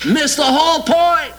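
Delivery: a preacher's indignant second blow; emphatic repeat. Real, not acted. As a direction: repeat it with force and a big pitch lift.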